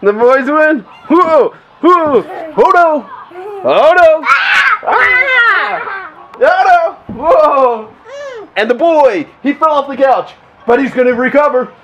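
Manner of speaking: high, loud sportscaster voice; play-by-play commentary. Acted. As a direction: call the fight like a wrestling match. Dad commentates pillow fight.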